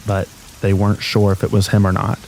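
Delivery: plain reading voice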